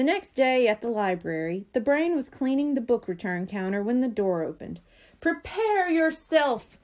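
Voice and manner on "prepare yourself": slow, high-pitched dramatic announcement; crying out. A narrator's reading. Buster bursting into the library, crying out a warning. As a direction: draw it out as a grand warning. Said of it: "cried Buster".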